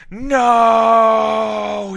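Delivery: loud high scream of despair; dying villain's scream. A narrator's reading. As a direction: scream it out as he's stabbed. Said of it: "he screamed".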